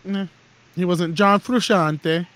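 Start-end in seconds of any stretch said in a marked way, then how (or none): 1.1-2.3 John Frusciante slowed, a bit louder, froo-SHAHN-tay; a touch of mock Italian flair; mock Italian pronunciation. Shown as a demonstration. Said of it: "say it like that from now on".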